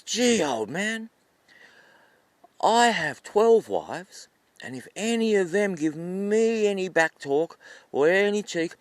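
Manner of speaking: high, drawn-out, loud boasting; boastful rooster. Acted. Rooster boasting to old man of twelve wives, cocky. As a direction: lecture grandly with stretched, emphatic words.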